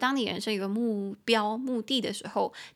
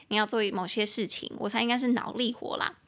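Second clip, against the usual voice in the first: normal talking voice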